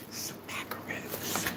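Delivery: slow breathy whisper of the word. Pretend. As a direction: whisper it like a secret. Whispered repeat of the price word.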